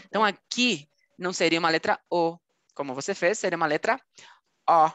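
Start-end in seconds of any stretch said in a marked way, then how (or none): 4.7-4.9 O said wide open and exaggerated. Shown as a demonstration.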